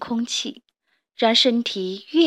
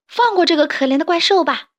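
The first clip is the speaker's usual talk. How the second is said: put-on higher, louder child's plea; 6 semitones higher, 5 dB louder, faster than usual. Little toad calls out to spare the monster, high loud plea. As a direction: call out boldly to stop it. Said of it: "他叫道".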